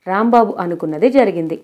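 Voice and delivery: ordinary delivery, nothing marked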